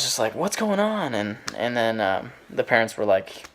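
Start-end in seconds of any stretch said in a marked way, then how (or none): 0.3-1.1 higher-pitched, mildly confrontational question; mock an irritated demand, pitch jumping up; retold aggressive demand. Neighbor confronting the noisy household late at night, mildly aggressive. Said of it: "I was really aggressive".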